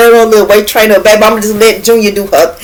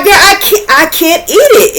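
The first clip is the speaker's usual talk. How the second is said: retold distress; 9 semitones higher than usual. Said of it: "I'm like".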